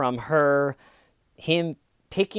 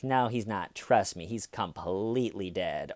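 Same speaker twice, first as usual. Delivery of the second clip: low, soft, unbothered reply; casually certain, pitched down; mock dismissive; 5 semitones lower, 7 dB quieter than usual. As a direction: imitate a caller who has written him off. Mimicking caller's dismissive answers.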